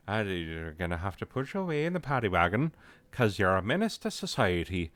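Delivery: hammed-up Irish accent, quick at first; Irish cop. Cop hauling a menace off to the paddy wagon, Irish accent. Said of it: "that cop started to turn Irish".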